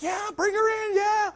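very high mock-girlish shout; excited girls' cheer. Girls at camp welcoming the storyteller, excited high shouting. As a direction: imitate squealing campers cheering her in.

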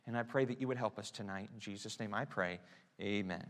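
a soft, earnest pastor's prayer. Acted. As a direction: pray quietly over the room. Leads the congregation in prayer.

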